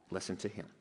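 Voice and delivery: very soft, low "Listen to him" in one breath; hushed echo. Retold or imitated. Preacher repeating God's command to the congregation, hushed echo. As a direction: let the words sink in, barely voiced.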